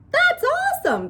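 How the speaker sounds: very high, loud cheer; excited. A narrator's reading. Bat hearing the kit isn't sick, excited. Boy thrilled the baby skunk is healthy.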